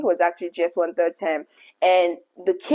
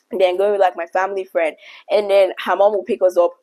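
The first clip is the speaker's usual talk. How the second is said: ordinary talk, nothing marked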